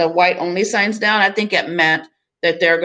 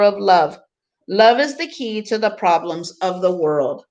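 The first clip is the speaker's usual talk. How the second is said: expressive picture book reading; reading to children; 3 semitones higher than usual. Acted. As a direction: read the book to the class with gentle emphasis. Teacher reads picture book aloud.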